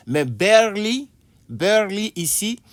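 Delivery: barley said high as bèrli, slowed; teacher models barley meaning à peine for the class; model pronunciation. Shown as a demonstration. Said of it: "ça veut dire à peine".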